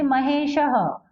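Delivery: slow महेशः ending in a separate "ha"; visarga as "ha". Shown as a demonstration. Teacher giving a masculine name as example, slow visarga "ha". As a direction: draw out महेशः as mahesha-ha. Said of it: "विसर्ग कहाँ होता है?".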